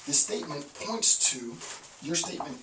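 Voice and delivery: normal talking voice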